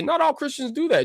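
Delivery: high mocking singsong; mock retort. Streamer mocking Joy Taylor's argument, in a high sarcastic voice. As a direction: throw their own line back at her, sarcastically. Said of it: "I can say".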